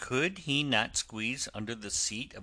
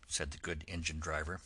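plain reading voice

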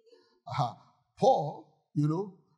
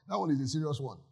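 usual speaking voice

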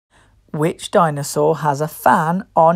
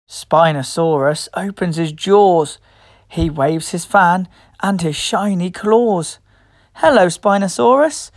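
ordinary delivery

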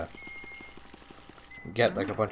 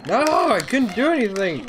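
frustrated shout; 12 semitones higher, 6 dB louder than usual. Real, not acted. Frustrated gamer yelling.

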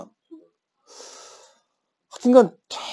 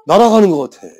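excited; 3 semitones higher, 9 dB louder than usual. Real, not acted. Excited memory of running away.

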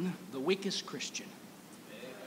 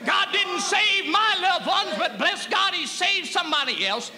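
preaching at full force; 10 semitones higher, 7 dB louder than usual. Real, not acted. Preacher shouting joy over grace to the congregation.

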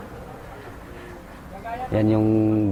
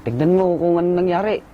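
higher, fast urgent order; 6 semitones higher, faster than usual. Retold or imitated. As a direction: push him to go check. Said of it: "Sabi niya sa akin". (mabilis at mataas na utos)

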